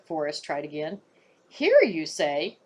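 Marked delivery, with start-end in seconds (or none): 1.5-2.6 slow, doubtful grumble; grumbling. Acted. Warder grumbles doubtfully at the boy's claim. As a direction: grumble it back slowly, rising. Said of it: "Warder Marsh grumbled".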